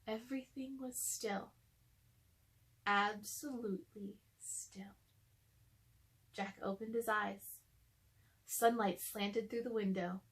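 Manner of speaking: ordinary delivery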